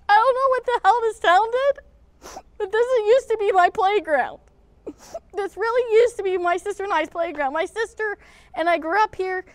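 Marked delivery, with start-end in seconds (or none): 0.1-6.9 raised, higher and louder in disbelief; indignant rant. Real, not acted. Vlogger ranting about the neglected town pool, indignant and raised. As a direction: vent your outrage at the town.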